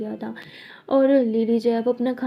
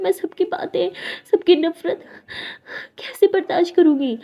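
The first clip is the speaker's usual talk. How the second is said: higher, louder, tearful outpouring; tearful lament; 5 semitones higher, 5 dB louder than usual. Acted. Lakshmi alone, crying over losing her reputation at college. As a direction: fight back sobs as the fear spills out.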